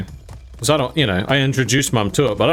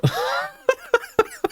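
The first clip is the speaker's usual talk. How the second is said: high burst of laughter; 4 dB quieter than usual. Real, not acted. Laughing at own story.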